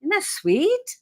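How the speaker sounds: lightly mock-posh British question. Wife admiring the cup in mock British.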